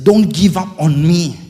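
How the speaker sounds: slow, loud, high desperate plea; a desperate cry to God. Retold or imitated. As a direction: beg God not to give up, slowly. Pleading prayer to God.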